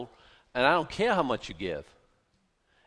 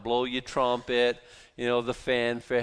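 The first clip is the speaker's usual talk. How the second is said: normal talking voice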